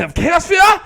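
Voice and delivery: high, loud hammed-up villain cry; mock villain announcement. Repeating the album name back, as a mock villain. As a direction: proclaim it like a cartoon menace.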